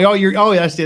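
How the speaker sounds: ordinary talk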